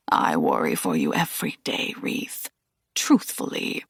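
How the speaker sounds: put-on low, rasping old master; a frail elder's rasp, very low; low rasping old man murmuring; old Aslan.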